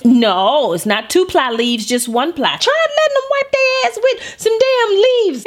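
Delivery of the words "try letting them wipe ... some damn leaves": squealing outrage. Real, not acted. Mock outrage.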